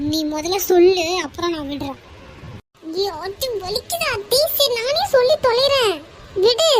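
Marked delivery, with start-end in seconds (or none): 3.0-6.7 whining in pain, rising higher; exasperated, hurting surrender; pained pleading. Acted. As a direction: plead to be let go. Painfully held, agrees to tell.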